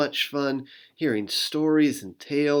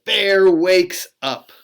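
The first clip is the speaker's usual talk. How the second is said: louder, booming announcement; big dramatic reveal; 5 semitones higher, 9 dB louder, slower than usual. Acted. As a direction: burst out with the surprise. Dramatic bear waking.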